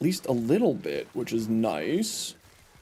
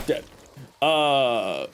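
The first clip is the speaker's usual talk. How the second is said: normal talking voice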